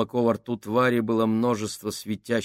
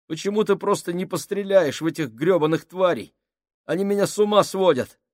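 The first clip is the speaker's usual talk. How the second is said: much higher, louder, rushing; angry shout; 9 semitones higher, 4 dB louder than usual. Acted. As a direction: yell it out, fed up. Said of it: "выругался Мюнхгаус". (громкий раздражённый крик)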